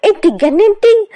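high, loud, shocked mother scolding; outraged mother. Acted. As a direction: snap at the daughter in alarm.

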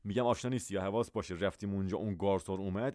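normal talking voice

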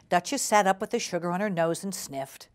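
ordinary delivery

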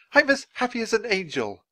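high-pitched, giddy exclamation; joyful shout. A narrator's reading. Actor playing Scrooge celebrates on stage, joyful shout. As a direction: cry out in wild joy. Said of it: "he shouted".

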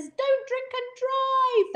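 drawn-out, high mock slogan. Host proudly quotes their chariot slogan, slow high slogan voice. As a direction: chant it slowly like a slogan. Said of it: "And there's our little slogan. It says".

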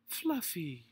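put-on high, loud baby-talk coo. A narrator's reading. Missus Grumby to her hurt cat, cooing. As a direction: coo to a beloved pet. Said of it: "she cooed".

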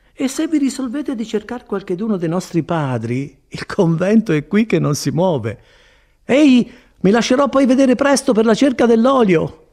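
high-pitched friar calling after a visitor; friar, cheerfully obliging. A narrator's reading. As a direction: send her off chirpily as the friar.

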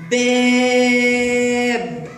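باب with the aa half sung, held very long; long drawn-out vowel. Shown as a demonstration. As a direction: sing out the aa in باب. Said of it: "إنه فتحة ممدودة لأعلى".

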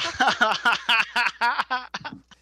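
loud, high burst of laughter; real laughter. Real, not acted. Podcast host cracking up at co-host's remark, loud laughing. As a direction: laugh out loud in a long run.

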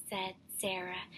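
ordinary delivery, nothing marked

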